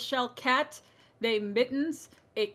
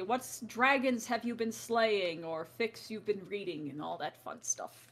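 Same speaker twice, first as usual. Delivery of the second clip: ordinary delivery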